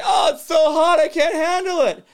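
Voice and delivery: high, loud hammed-up whine; mock loud complaint. Host imitating people stepping into the heat, mock shouted whine. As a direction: overact someone wilting in the heat. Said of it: "and we're going".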